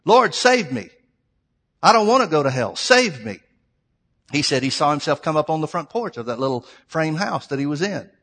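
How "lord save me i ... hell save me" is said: loud plea for rescue, voiced for someone else; urgent retold prayer. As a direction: call out to God as if desperate. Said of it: "and he starts praying".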